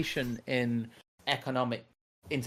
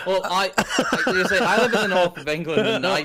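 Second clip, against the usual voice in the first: laughing through a protest, pitched higher and louder; 6 semitones higher, 8 dB louder than usual. Real, not acted. Answering a viewer's jab while laughing loudly. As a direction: laugh it off while correcting them. Teased about being rich and southern.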